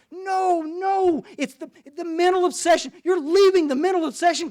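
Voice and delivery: high, loud, insistent preaching; hammering it home. Retold or imitated. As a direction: drive the point up the ladder. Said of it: "he's hammering the big book to this guy".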